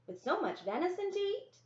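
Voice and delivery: put-on high child's voice, doubtful; a small boy questioning his sister; little brother. Brother doubts the fishing trip, in a high child's voice.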